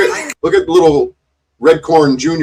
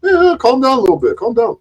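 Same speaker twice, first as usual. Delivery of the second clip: high, quick put-on voice; imitated calming down; 9 semitones higher, faster than usual. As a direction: imitate someone brushing off a fuss. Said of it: "He's simply telling him".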